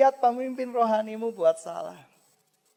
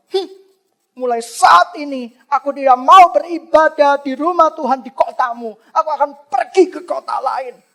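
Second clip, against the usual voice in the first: angry outburst; 6 semitones higher, 14 dB louder than usual. Pretend. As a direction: pretend furious shout at the priest, higher and much louder. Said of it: "Dia bisa marah kepada Imam". ([name] pura-pura marah berteriak keras kepada imam)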